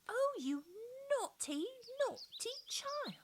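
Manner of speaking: high, loud scolding cry; indignant cry. A narrator's reading. Mamie scolding the rain-dripping child, indignant high cry. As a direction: scold the naughty child sharply. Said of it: "Mamie cried indignantly".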